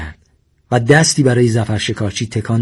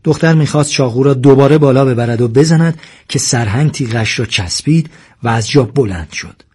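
usual speaking voice

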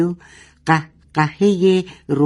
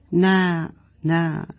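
plain reading voice

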